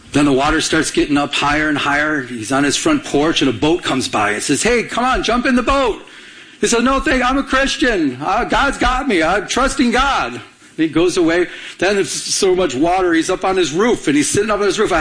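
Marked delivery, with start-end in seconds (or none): 4.6-6.0 higher-pitched boatman calling out; urgent rescuer. Acted. Boatman calls to the man on his porch, urgent rescuer. 6.8-10.3 high, drawn-out polite brush-off; confident refusal. Acted. Stranded man refuses rescue.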